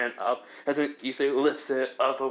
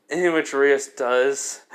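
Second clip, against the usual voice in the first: plain reading voice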